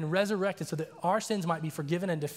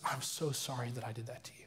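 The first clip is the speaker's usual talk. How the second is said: low, very soft retold apology; hushed apology; 5 semitones lower, 9 dB quieter than usual. Jacob apologizing to the man he attacked, hushed. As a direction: drop the voice, quietly contrite.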